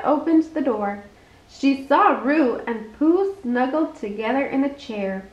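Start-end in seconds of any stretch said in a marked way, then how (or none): none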